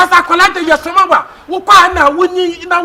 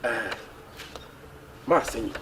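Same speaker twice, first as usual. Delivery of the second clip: very low, soft voice; quiet, low-pitched confidence; hushed confiding; 20 dB quieter than usual. Acted. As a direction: share a secret under your breath. Confiding a secret errand to someone.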